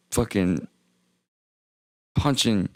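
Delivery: ordinary talk, nothing marked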